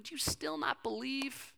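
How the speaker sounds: high, slow imploring question; acted pleading. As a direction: beg them gently to believe. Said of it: "he's pleading with them".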